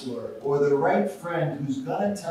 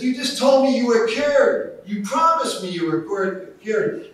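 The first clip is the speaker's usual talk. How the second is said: angry shout; 9 semitones higher, 8 dB louder than usual. Retold or imitated. Waiter furious at repeat offender.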